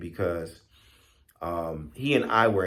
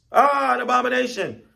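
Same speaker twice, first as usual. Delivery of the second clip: very high, loud cry of alarm; squirrel shriek; 6 dB louder than usual. Acted. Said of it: "squirrel shrieks".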